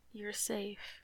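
ordinary delivery

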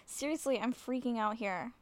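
ordinary talk, nothing marked

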